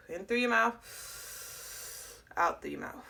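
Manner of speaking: "In through your mouth" drawn out very slowly, "out" breathed and unvoiced; slow guided breathing. Shown as a demonstration. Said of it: "you're gonna breathe in through your mouth".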